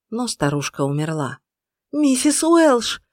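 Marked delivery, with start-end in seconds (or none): 1.9-3.0 протяжный громкий высокий крик; кричи, растягивая слова. A narrator's reading. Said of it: "кричала Иден". (протяжный крик)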